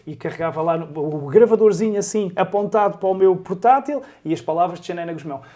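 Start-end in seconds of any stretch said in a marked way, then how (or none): none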